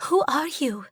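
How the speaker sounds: high, soft, frightened; a scared sister shrinks back; fearful whisper. A narrator's reading. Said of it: "she whispers in fear".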